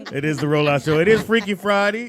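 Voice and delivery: laughing while announcing. Real, not acted. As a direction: laugh through the intro. Laughing through show intro.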